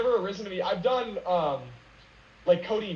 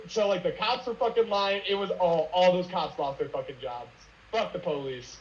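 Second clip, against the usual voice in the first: usual speaking voice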